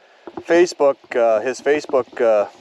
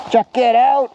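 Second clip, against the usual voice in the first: excited shout; 11 semitones higher, 4 dB louder than usual. Real, not acted. Excited shout at shoe tree.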